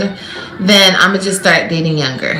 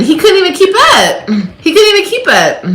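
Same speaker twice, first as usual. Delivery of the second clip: laughing; 11 semitones higher than usual. Real, not acted. Laughing retelling, high pitch.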